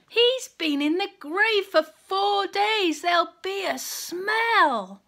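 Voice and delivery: high, slow pleading objection; anxious protest. Acted. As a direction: plead it's too late, high-pitched. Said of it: "cried Martha".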